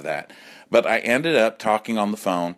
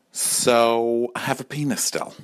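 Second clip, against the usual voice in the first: normal talking voice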